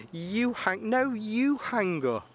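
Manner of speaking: high, slow, sing-song lovey-dovey voice; giggly late night call. Pretend. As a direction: coo it like a smitten teenager. Mock lovers' phone goodbye.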